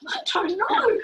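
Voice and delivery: high-pitched, clueless retort; retold bafflement. Learner answering her family about French, baffled.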